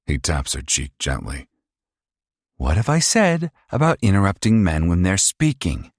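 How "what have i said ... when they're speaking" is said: high, loud, fast mocking sneer; sneering abusive son. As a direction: taunt her like a cruel bully. Paul scolds Isla.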